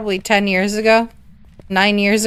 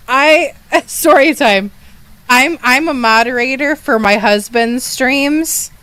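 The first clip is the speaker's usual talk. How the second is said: ordinary talk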